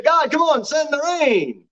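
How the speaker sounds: mock complaining. People begging God for rain in drought, high mock complaint. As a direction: whine upward impatiently, stretching the last words. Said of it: "and we say".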